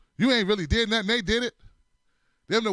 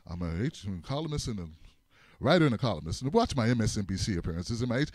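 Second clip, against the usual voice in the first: low, self-important droning; lower pompous drone; 10 semitones lower, 3 dB quieter than usual. Retold or imitated. Friend bragging at dinner, in a lower pompous drone. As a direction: drone on about yourself.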